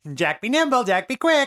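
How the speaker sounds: high-pitched riddle-giver voice; Mago. Acted. Game master voices the riddle in the tunnel, high chant. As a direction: chant the rhyme high and emphatic. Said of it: "the Mago one last time was like".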